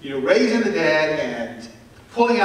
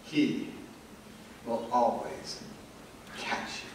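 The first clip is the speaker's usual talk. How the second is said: softer and softer, fading into a whisper; tender reassurance; 5 semitones lower, 12 dB quieter than usual. Real, not acted. Preacher comforts the congregation, fading to a whisper. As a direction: reassure them gently, trailing off.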